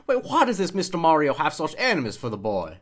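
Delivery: put-on broad Deep South accent, affronted question; Deep South tobacco CEO. CEO watching Super Mario gameplay, indignant Deep South accent. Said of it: "He's a Deep South Tobacco C E O".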